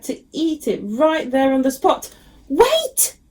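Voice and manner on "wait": high squeaky mouse cry. A narrator's reading. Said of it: "squeaked Mister Mouse".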